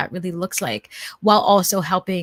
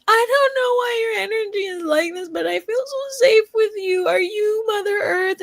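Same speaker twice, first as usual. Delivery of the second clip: high, teary retold sobbing voice, much higher; much higher crying voice ending in a teary shout; crying fan; 12 semitones higher, 3 dB louder than usual. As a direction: imitate a stranger weeping out the words, much higher and a bit louder. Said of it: "one of them was just burst into tears".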